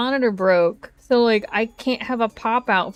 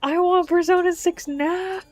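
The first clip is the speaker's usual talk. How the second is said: high, squealing fake excitement; mock hype reaction; 9 semitones higher, 3 dB louder than usual. Streamer parodying hyped trailer reactions. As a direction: ham up a fanboy reaction. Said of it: "react to Persona six and be like".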